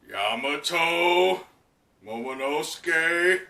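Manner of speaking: slow, drawn-out names; menacing Kaido. Acted. Kaido eyeing his daughter and Momo, slow menace. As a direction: savour each name as a threat. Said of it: "Kyle just looking over at him".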